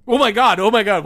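loud shocked squeal; shrieking jackpot winner. Real, not acted. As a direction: shriek like you just won big. Learns poem written by Paul Ryan.

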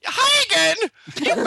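high, slow, sing-song hello; mock cheery greeting. Fake foreign blogger greeting readers, high and slow. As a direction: stretch out a goofy hello.

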